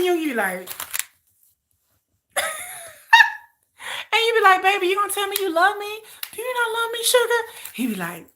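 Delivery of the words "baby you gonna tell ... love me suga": higher whiny plea; pleading girlfriend. Retold or imitated. Girlfriend begging [name] to say he loves her, high and whiny. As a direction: beg him to say it.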